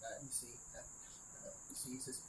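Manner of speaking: normal talking voice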